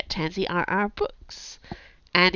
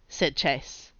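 ordinary delivery, nothing marked

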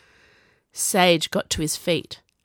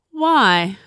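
louder, higher drawn-out why; drawled; 4 semitones higher, 6 dB louder than usual. A narrator's reading. Viola questioning Sage's caution, drawling. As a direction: stretch the question with lazy scorn. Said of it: "Viola drawled".

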